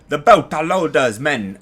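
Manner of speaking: a hint of a badly faked Belter accent; terrible Belter accent. Reviewer naming the enemy crew in a terrible Belter accent. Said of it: "that was a terrible accent".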